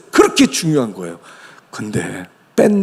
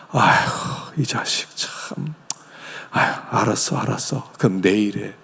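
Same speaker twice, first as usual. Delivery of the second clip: sighing father; 5 semitones lower, 7 dB quieter than usual. Retold or imitated.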